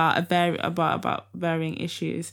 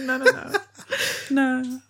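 real laughter breaking through, higher voice; laughing refusal; 4 semitones higher than usual. Real, not acted. Host holding back a rude remark, laughing refusal. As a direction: refuse while giggling.

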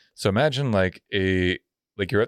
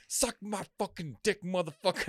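stretched-out high insult, acted; angry homeless guy; 9 semitones higher, 8 dB quieter than usual. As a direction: imitate a belligerent street man, softer. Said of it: "And he was like".

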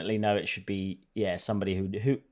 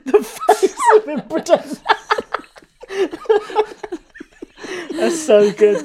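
high, loud laughing words; 6 dB louder than usual. Real, not acted. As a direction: squeal through laughter.

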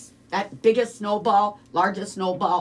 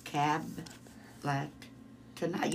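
backwards speech; 4 semitones lower, 12 dB quieter than usual. Shown as a demonstration. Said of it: "do that backwards".

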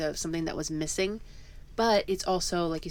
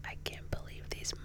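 angry words whispered calmly; seethe, but in a whisper; enraged ASMR; 7 semitones lower than usual. Acted. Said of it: "enraged A S M R".

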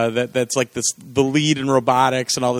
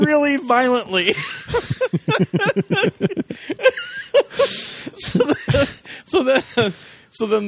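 high, breaking up into laughter mid-story; real laughing; 13 semitones higher, slower than usual. Real, not acted. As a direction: struggle to get the story out through laughter. Cracking up telling the monkey gag.

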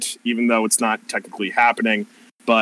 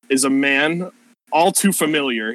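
ordinary talk

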